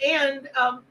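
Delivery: ordinary delivery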